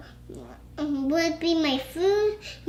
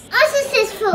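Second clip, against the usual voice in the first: louder, higher laughing word; 8 semitones higher, 8 dB louder, faster than usual. Real, not acted. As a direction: burst into a laugh on it. Child reading, laughs at word.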